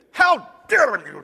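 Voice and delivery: outraged and insulted; deeply offended. Retold or imitated. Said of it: "Deeply offended".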